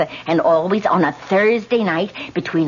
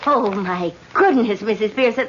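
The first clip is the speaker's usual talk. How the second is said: ordinary talk, nothing marked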